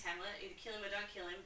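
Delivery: ordinary delivery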